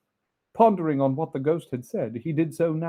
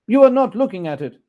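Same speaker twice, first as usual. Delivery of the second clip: higher, loud, fast nervous miser; Scrooge voice; 8 semitones higher, 11 dB louder, faster than usual. Acted. Scrooge accusing the ghost, high and fast. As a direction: press the ghost quickly.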